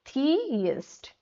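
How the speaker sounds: slow, careful थियस्ट in two syllables; say it as thiyast. Shown as a demonstration. Teacher to students, slowly sounding out थियस्ट. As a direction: spell out थियस्ट as thi-yast, drawn out. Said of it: "थीस्ट नहीं बोलना".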